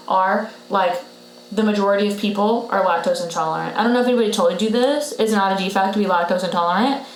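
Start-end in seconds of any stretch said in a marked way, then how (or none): none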